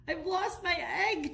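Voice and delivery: high, tearful penguin wail; a lightly acted sob over the lost egg; sobbing. A narrator's reading. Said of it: "Charlie sobbed".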